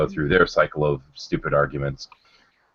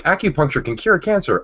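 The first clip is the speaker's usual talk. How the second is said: mocking quote; 11 semitones higher than usual. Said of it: "you see it all the time, like".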